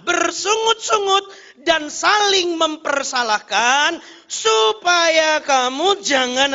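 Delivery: high, loud, slow proclamation; preaching scripture reading. Real, not acted. Preacher reads the warning with the congregation, loud slow proclamation. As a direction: declaim it with stretched, weighty words.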